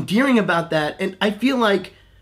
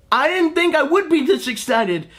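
excited; 10 semitones higher than usual. Real, not acted. Gushing about the Pooh movie.

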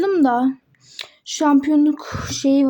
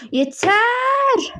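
one shouted word, pitched high; sharp shout; 7 semitones higher than usual. Acted. Coach silencing the squabbling players with a high shout. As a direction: bark it to shut everyone up. Coach pony cuts off arguing team.

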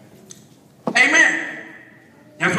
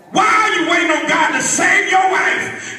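preaching at full force; 4 semitones higher than usual. Acted. Pastor pressing the church about witnessing. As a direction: drive each question home.

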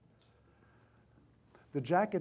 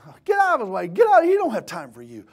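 high, loud acted yell; preacher mimicking an indignant crowd, dropping at the end; religious people screaming; 7 semitones higher, 11 dB louder than usual. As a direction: shoo someone off with a scream. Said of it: "religious people were screaming".

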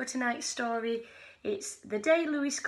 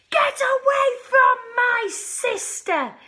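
loud, high, slow shout; big protective shout at the hungry creatures; 12 semitones higher, 8 dB louder, slower than usual. Acted. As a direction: yell it to protect your sister. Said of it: "shouted Lewis".

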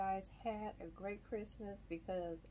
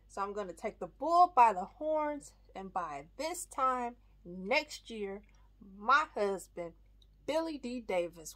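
acted pitch with dramatic pauses, building to loud, emphatic lines; audition monologue; 8 dB louder than usual. Wife pitching Erica a candy bar idea, theatrical audition monologue. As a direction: perform it like an audition, punching key phrases louder. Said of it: "I'm gonna get into this monologue".

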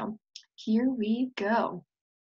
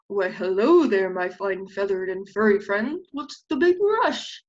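smooth, oily sly greeting; sly coyote; 5 dB louder than usual. Acted. Sly coyote sweet-talks the little animals. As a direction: charm them like a trickster.

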